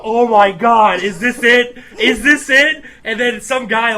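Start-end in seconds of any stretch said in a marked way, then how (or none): 0.0-2.8 excited. Retold or imitated. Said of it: "And I just go".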